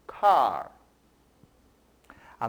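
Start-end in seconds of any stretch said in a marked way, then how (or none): 0.1-0.7 car said the English way, for students to repeat. Shown as a demonstration. Teacher models car for students to repeat, in English pronunciation. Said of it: "Mais uma vez".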